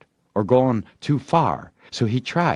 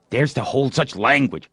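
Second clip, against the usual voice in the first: prompting; 5 semitones higher than usual. A narrator's reading. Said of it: "said Tom, prompting".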